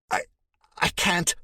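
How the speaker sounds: high, strangled words; choked. A narrator's reading. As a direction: force the words out through a tight throat. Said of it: "the words choked".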